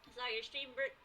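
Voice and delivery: ordinary delivery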